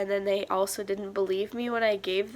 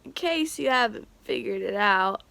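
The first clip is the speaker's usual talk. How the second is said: usual speaking voice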